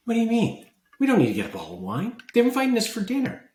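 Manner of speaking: high, fast mock disbelief; incredulous scoff. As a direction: act baffled, protest quickly and high. Retold scoffing at wife over wine.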